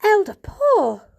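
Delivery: loud, high, slow cry; cried out. A narrator's reading. Mother cries out at the elder, loud and high. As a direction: cry his name out in alarm. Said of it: "cried Mother".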